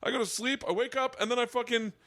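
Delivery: high-pitched, scoffing mock dad; indignant dad. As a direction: protest as a dad offended by the question. Acting dad scoffing at dreaming.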